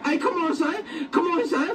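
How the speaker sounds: ordinary talk, nothing marked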